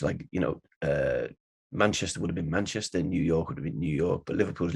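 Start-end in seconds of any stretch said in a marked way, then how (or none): none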